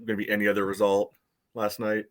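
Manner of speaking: usual speaking voice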